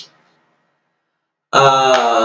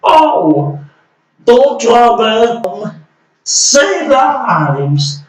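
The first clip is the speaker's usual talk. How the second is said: mocking impression; 7 semitones higher, much slower than usual. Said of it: "And he's saying".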